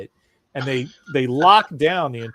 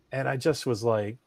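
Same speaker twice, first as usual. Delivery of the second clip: ordinary talk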